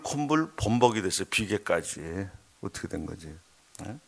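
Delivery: ordinary talk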